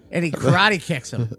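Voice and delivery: usual speaking voice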